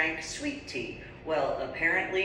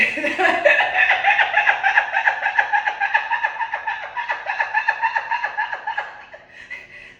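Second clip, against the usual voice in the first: long, high peal of laughter; real helpless laughing fit; big laugh; 8 dB louder than usual. Real, not acted. Laughing at her own joke.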